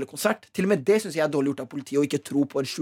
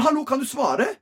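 imitating a raised voice, much higher pitched; retold raised voice; 10 semitones higher than usual. Retold: confronting police officer during the search, raising his voice. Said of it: "Jeg begynner å heve stemmen min".

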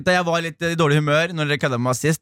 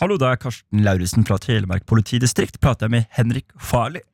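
much lower, official police caller voice; fake police officer; 6 semitones lower than usual. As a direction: play it straight as a stern local cop on the phone. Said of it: "en ganske god prank der".

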